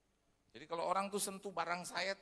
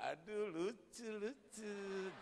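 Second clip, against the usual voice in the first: slow, high mock cooing; slow put-on gushing; fake sweet praise; 4 semitones higher, much slower than usual. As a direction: coo over the kid for the parents' sake. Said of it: "Depan orang tuanya saya bilang".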